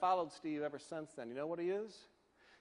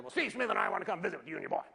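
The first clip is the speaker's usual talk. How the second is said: imitating owner's voice; 3 semitones higher, 4 dB louder, faster than usual. Imitating Richardson on phone.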